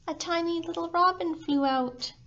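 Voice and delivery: usual speaking voice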